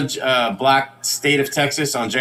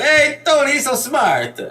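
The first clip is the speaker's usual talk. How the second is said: family yelling; 9 semitones higher than usual. Acted. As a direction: shout his praise across the courtroom. Family cheers Tony in court.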